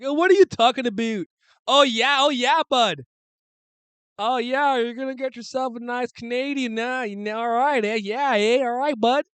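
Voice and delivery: loud, high-pitched cartoon Canadian accent; hammed-up Canadian accent, belted out high; South Park Canadian. Streamer mocking Albertans to viewers, loud Canadian caricature. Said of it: "Canadians that, like, South Park makes fun of".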